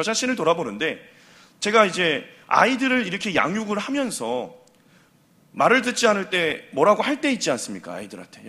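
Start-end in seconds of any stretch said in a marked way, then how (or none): none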